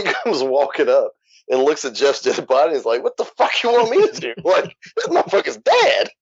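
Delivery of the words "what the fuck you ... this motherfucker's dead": high-pitched mocking security guard voice; mocking rent-a-cop. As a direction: squeak it out like a dismissive rent-a-cop.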